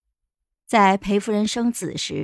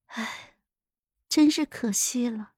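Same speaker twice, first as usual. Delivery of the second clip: a soft, sighing regret; light sigh; 2 semitones higher, 5 dB quieter than usual. A narrator's reading. Empress to the narrator over the dead, sighing with pity. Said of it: "轻叹道".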